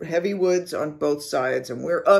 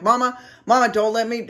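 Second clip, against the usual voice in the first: raised, urgent plea to a bear; retold screaming; 5 semitones higher, 5 dB louder than usual. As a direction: call out higher and louder, pleading. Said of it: "I'm screaming this".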